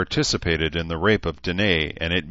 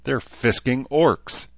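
slow, higher cry of disbelief; can't believe his eyes; drawn-out shocked exclamation; stunned disbelief; 7 semitones higher, 3 dB louder, slower than usual. A narrator's reading. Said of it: "Herzer muttered, rubbing at his eyes".

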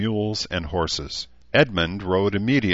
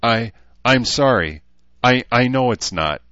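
halting, higher-pitched apology; flustered apology; 5 semitones higher, 6 dB louder than usual. Acted. Rachel apologizes to Herzer after her anger, flustered. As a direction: stumble over the words, trying to make amends.

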